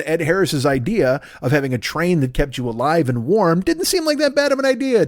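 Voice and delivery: ordinary talk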